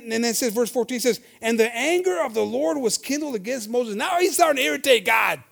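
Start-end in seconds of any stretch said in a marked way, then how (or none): 1.4-4.0 loud, slow solemn reading; scripture reading. Acted. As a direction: proclaim the verse with weight. Scripture read aloud.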